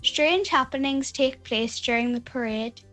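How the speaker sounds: ordinary talk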